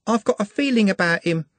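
put-on high, girlish voice, lovestruck; high girlish voice, dreamy; dreamy young girl. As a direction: sound like a smitten young shopgirl. Juliet's voice.

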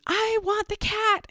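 very high mock toddler screech; imitated child scream. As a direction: imitate a little girl screaming a demand. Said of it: "little lady is screaming".

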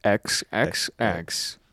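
very slow, separated "X X X"; measured teacher-like demonstration, one letter at a time; leading a repeat-after-me. Shown as a demonstration. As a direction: spell it out slowly for others to follow. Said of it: "say it with me".

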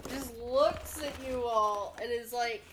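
normal talking voice

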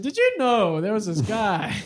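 lightly voiced old dealership guy spinning a tale; hint of an excited yarn-spinner; imitating old storyteller. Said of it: "the old guys of, like, the dealership would tell us".

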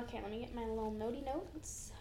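quiet whispered aside. Real, not acted. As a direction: whisper it to yourself. Aside while fetching notes.

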